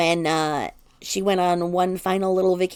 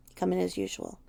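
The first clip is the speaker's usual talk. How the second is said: usual speaking voice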